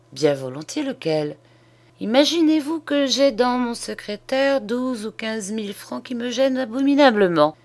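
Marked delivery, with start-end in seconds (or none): 2.0-7.6 lightly voiced old man, higher pitch; fussy old bonhomme. A narrator's reading. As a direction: confide a bothersome worry, lightly in character. (vieux bonhomme légèrement joué, voix plus aiguë)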